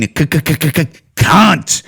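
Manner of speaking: stammered build-up to a swear; mock struggle to get the word out; deliberate stutter. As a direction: stutter on the first sound, then release the word. Teasing stutter before the taboo word.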